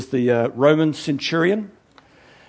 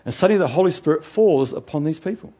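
ordinary talk, nothing marked

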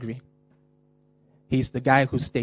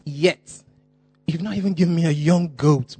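louder and higher, hurt and accusing; indignant complaint; 5 semitones higher, 6 dB louder than usual. Acted. Older son accusing his father, loud and hurt. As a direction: throw the grievance at Daddy.

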